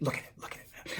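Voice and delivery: whispered look at it. Real, not acted. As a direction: breathe it out softly. Whispered aside.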